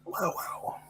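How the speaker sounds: breathy whispered wow; whispering. Real, not acted. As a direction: whisper it in disbelief. Reacts to six-month deadline.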